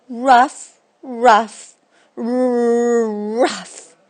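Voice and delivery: loud yapping dog barks; barking rough. Acted. Dog barking rough at the junkyard guy. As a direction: bark the word like a pup.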